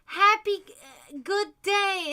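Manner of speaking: high, slow chirpy farewell; imitating coworker's slip. Coworker cheerfully wishing leaving guests a happy good day. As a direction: sing it out brightly to the guests. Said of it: "She's like".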